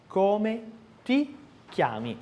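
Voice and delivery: ti with a crisp dry T, tongue behind the teeth. Shown as a demonstration. Teacher modelling the dry T in ti. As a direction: tap the T of ti sharply. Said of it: "é um T seco".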